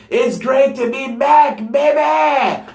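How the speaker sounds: high, slow, yelled wrestler catchphrase; loud hammed-up promo shout; Dean Ambrose promo. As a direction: belt it out like a wrestler hyping the crowd. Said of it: "he's doing his typical Dean".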